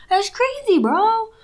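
high-pitched, incredulous exclamation; mock amazement. Exclaims over moon fact.

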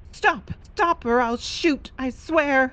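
high shouted warning, gun drawn; calling out a warning. Acted. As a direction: yell stop at the struggling pair. Player calls out holding gun.

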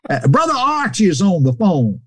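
very high, hearty welcome; imitated old preacher, high and exuberant; Brother Cates. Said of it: "I hear Brother Case".

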